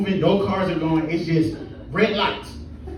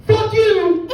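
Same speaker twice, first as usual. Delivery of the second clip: high yelled curse at the driver; hammed-up angry yell; mock shout; 12 semitones higher, 4 dB louder than usual. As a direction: spit it out high and loud. Homeless man curses bus driver.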